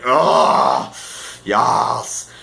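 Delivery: acted groan of delight over food; food ecstasy. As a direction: savor it like heavenly buffet food. Savoring delicious buffet food.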